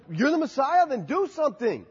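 retold taunt of the criminal on the cross; mocking criminal. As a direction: jeer at Jesus from the cross. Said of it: "he's like".

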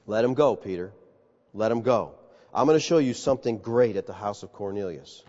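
low, soft "Let 'em go, Peter"; tender God. Acted. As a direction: gently release him.

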